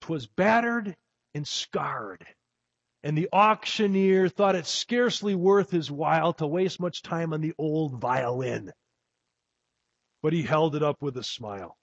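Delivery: a sermon poem read with feeling; storyteller's reading of a poem; poem recitation. Acted.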